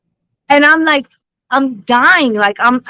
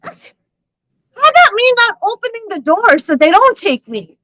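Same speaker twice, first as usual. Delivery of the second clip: indignant high; 10 semitones higher than usual. Real, not acted. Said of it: "arguing with husband on call".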